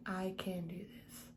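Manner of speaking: a soft, whispered pep talk to yourself; quiet morning affirmation, almost whispered; whispered self-encouragement. Shown as a demonstration. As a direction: breathe the words to yourself on waking. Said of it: "be like".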